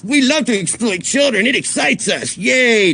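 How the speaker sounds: high, sneering parody of creepy fans; mocking creepy voice. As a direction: ham up a gleeful creep to ridicule them. Mocking the drama chat.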